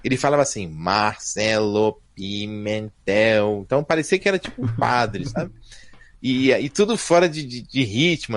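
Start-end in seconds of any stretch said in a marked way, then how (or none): 0.7-3.6 put-on low, very slow, dragged-out drunken priest; imitating drunken priest voice. Host mimicking an old synthesizer's voice, drunken priest drawl. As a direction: intone it like a tipsy priest, syllable by syllable. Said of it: "carinhosamente de padre bêbado".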